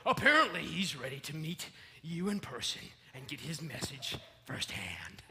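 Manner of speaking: ordinary talk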